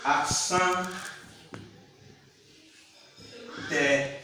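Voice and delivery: "accent aigu" broken up with long pauses; syllable-by-syllable demonstration. Shown as a demonstration. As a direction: dictate "accent aigu" piece by piece in French. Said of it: "We call it".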